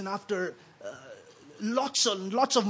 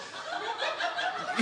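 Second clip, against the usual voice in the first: a high, airy burst of laughter; high breathless laugh; 4 dB quieter than usual. Real, not acted. Preacher laughing at himself hiding tears at a movie, airy high laugh.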